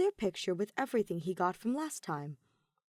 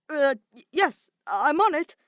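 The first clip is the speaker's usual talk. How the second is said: robot flustered by Maki's order; 8 semitones higher, 10 dB louder than usual. A narrator's reading. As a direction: stammer out an eager yes.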